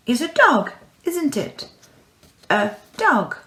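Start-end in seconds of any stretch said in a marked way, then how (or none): none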